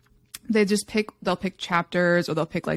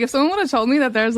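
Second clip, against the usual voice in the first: ordinary delivery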